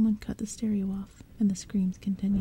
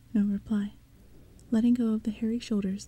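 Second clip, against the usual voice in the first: usual speaking voice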